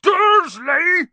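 huge, high furious bellow; boomed. A narrator's reading. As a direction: bellow the name in outrage. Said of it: "he boomed".

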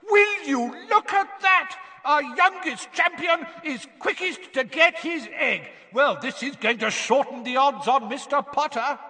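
high, far-off announcer voice; excited commentator. A narrator's reading. Bagman announcing to the stands, high and excited. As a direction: hype the youngest champion to the crowd. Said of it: "Bagman was yelling".

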